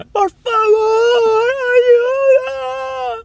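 chillido muy agudo pidiendo ayuda; grito agudo fingido. Retold or imitated. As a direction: imitando un grito desesperado, mucho más agudo. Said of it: "una pava ... que gritaba". (imitando a una pava que grita ayuda en la plaza, chillido agudo)

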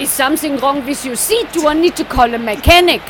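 loud, high mock German accent. Imitating the East German attendant asking the passenger in a mock German accent. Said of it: "she has a very strong accent".

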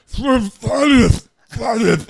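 high, loud squishy cry for Thaddeus; marshmallow calling out. Acted. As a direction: shout his name like a gooey blob. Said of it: "how I'm talking 'cause I'm a marshmallow".

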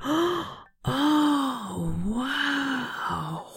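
soft breathy oh wow, rising; hushed amazement at the portal; awed gasp. Acted. Lucas gasping at the glowing portal in awe. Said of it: "as he said out loud".

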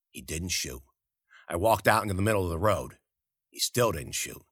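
plain reading voice

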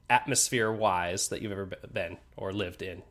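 usual speaking voice

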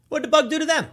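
hammed-up pity for bugs; mock indignation. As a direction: pretend to defend the bug.

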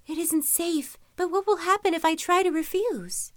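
high, anxious worried voice; frightened. A narrator's reading. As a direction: fret aloud in fear. Frightened girl weighs refusing.

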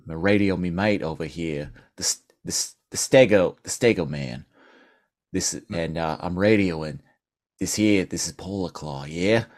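low, halting Australian radio call; Australian polar bear. Acted. Polar bear radioing his mate, low Australian accent. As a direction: keep it low and slightly fumbling, in Australian. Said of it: "leaning into my Australian polar bear".

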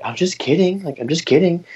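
squeaky high, singsong teasing; high girly mock voice. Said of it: "and she's like".

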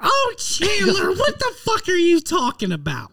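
man doing a woman's voice. Retold or imitated. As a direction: mimic his Pittsburgh mother scolding. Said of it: "do your impression of your mom".

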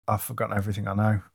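ordinary talk, nothing marked

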